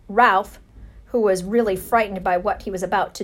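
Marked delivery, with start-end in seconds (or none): none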